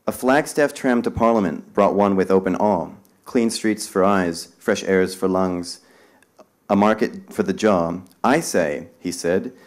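loud, slow, dramatic poem reading; spirited verse reading for a crowd; poem recital. Acted. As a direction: declaim it to the room like a performance. Reads poem aloud to audience.

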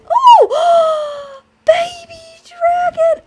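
loud, very high squeal; real thrilled shriek; excited shriek. Real, not acted. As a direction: gasp and squeal, drawing out the words. The dragon egg hatches.

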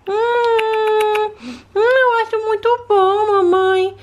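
put-on high childlike voice of a small daughter; little girl voice. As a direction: play the sick little girl talking to mom. Daughter doll tasting soup.

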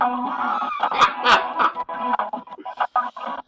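shrill burst of laughter; loud high laugh. Real, not acted. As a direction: cackle high and loud at them. After taunting, bursts out laughing.

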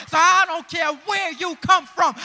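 loud preacher's peak; rousing sermon cry; shouted climax. Acted. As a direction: hit the final word hard.

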